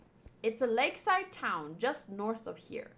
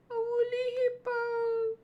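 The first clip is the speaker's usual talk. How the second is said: mock squeaky repeat; 10 semitones higher than usual. Shown as a demonstration. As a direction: parrot woolly hippo back in a tiny high voice. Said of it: "alias a woolly hippo".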